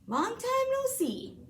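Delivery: cheery hare. Acted. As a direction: sing out a hello as the hare. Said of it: "says the hare".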